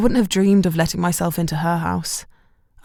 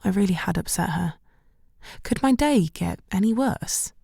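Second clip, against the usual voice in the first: ordinary delivery, nothing marked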